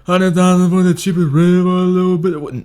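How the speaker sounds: high, loud, slurred mock rapper; mocking slurred mumble rap; mumbling Future impression. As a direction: garble the lyric lazily. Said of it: "I'll say it again".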